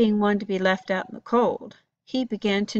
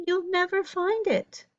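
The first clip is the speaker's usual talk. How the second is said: higher warning voice of Baba; grandmother warning; 10 semitones higher than usual. A narrator's reading. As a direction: warn him like a grandmother. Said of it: "she warned".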